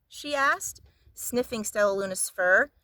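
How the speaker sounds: plain reading voice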